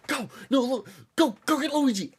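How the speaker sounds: high-pitched restrained yell; retold yelling. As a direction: imitate frantic yelling without full volume. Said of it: "I just start yelling".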